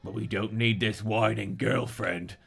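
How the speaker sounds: lightly put-on Cockney gunman; acted Cockney sneer; Cockney London. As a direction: dismiss her in a Cockney accent. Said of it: "sounds like Cockney London".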